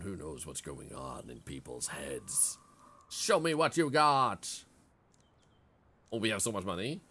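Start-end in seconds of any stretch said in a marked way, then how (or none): 0.0-2.6 ominous villager voice. Acted. Reading villager dialogue. 3.1-4.6 slow loud shopkeeper. Acted. Shopkeeper line read out.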